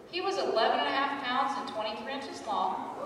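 ordinary talk

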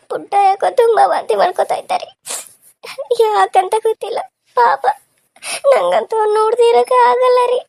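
loud, high-pitched wailing; crying. Acted. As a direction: wail to your husband about your sick baby. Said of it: "ಇಷ್ಟಕ್ಕೆಲ್ಲ ಯಾಕೆ ಅಳ್ತೀಯ". (ಜೋರಾಗಿ ಗೋಳಾಡುತ್ತಾ ಅಳುವುದು)

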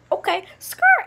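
high, loud catchphrase; squealed comeback. Acted. Acted comeback one wants to say.

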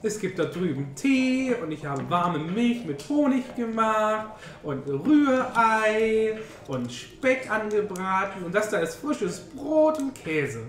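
ordinary delivery

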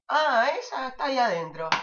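a hint of a casual classmate; voiced friend. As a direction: toss it off like a know-it-all friend. Friend answers, voiced differently.

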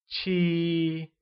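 Qi held high and level. Shown as a demonstration. Teacher modelling Qi with a high level tone for learners. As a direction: keep Qi flat and high. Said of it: "said with a high level tone".

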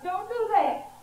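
high-pitched, restrained story yell; protesting yell. A narrator's reading. Teacup objecting to the potter's pounding, mock yelling. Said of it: "I yelled out".

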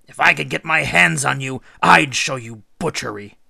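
loud, disgusted outburst; loud and contemptuous; disgusted threat. A narrator's reading. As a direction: hurl the threat up at her in disgust. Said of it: "Enkidu was disgusted".

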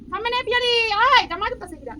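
calling someone over; calling out high. Real, not acted. Calling out to child.

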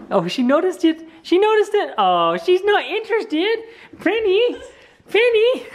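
high, gushing coo at a pet; excited dog talk. Real, not acted. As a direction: squeal with delight at the dog.

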